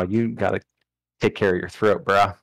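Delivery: ordinary talk, nothing marked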